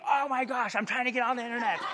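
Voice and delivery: high, frantic "oh my gosh"; mock panic at the phone interrupting; hammed-up annoyed teenager; exasperated. Caller interrupts dial-up connection.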